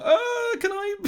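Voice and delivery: very high little plea; Doctor's eager question. Retold or imitated. Fan playing the Doctor turning back, high-pitched asking. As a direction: squeak it out hopefully, very high. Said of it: "turns back and is like".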